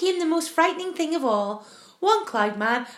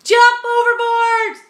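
shouting; 10 semitones higher, 10 dB louder, slower than usual. Acted. Panicked shout aboard the peach.

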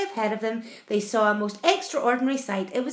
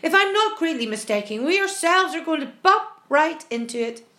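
raised, emphatic voice; sharp warning; 5 semitones higher, 6 dB louder than usual. A narrator's reading. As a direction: warn them sharply and loudly. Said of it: "the centipede said sharply".

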